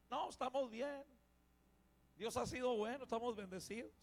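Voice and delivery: soft, polite refusal, brushing help aside; proud brush-off. Retold or imitated. Proud needy person refusing help, quietly brushing it off. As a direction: say you're fine when you're not. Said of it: "el orgullo no les deja recibir".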